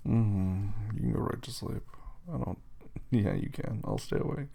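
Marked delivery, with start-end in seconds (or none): none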